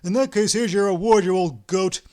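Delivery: loud, furious insult; angry threat. Acted. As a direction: shout it as you strike. Ostap strikes his partner.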